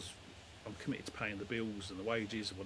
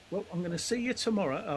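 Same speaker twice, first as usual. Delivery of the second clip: put-on higher, cheery old lady; a lightly voiced old neighbour; 9 semitones higher, 7 dB louder than usual. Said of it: "She goes".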